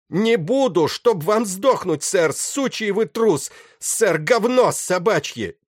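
slow, very high, loud terrified defiance; hysterical shouting. A narrator's reading. Doomed captain cursing the pirate, shouting hysterically. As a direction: hurl each curse out in high-pitched hysteria. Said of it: "истерически надтреснутого, сломленного ужасом".

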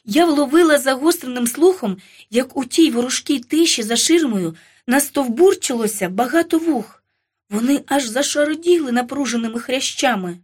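usual speaking voice

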